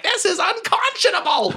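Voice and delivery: high, hammed-up indignant proclamation; outraged boss. Brothel owner proclaiming outrage over the statue. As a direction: pronounce it with mock grandeur. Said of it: "And Chaz is like".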